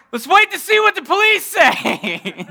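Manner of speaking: high, loud plea edged with laughter; panicked pleading. Retold or imitated. Husband panicking his prank unravels.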